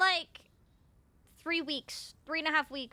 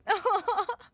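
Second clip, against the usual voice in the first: high delighted giggle; 7 semitones higher, 7 dB louder than usual. Real, not acted. Delighted by cat.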